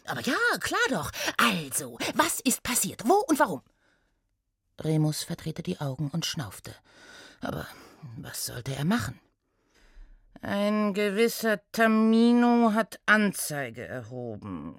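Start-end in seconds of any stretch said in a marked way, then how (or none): 0.1-3.6 quick, high, bubbly questions; eager gnome. Acted. Intern to inspector, quick and eager. 10.4-14.8 reluctant centaur. Acted. Inspector briefing his intern, reluctant drone.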